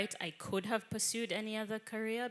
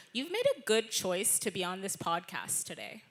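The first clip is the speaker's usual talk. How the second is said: Californian American accent; relaxed California American accent. Shown as a demonstration. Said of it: "the American one would be".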